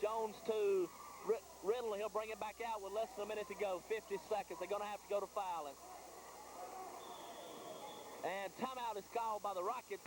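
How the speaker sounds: ordinary delivery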